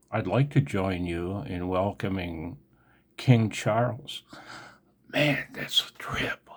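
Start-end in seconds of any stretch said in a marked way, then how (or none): 5.1-6.5 whispered in disbelief; awed whisper. Real, not acted. To viewers about King Charles, whispering in awe. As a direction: breathe it out, stunned.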